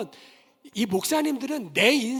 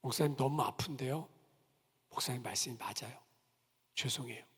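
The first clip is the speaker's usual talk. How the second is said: hurt quiet apology; 7 semitones lower, 8 dB quieter than usual. Retold or imitated. Deacon hurt but accepting.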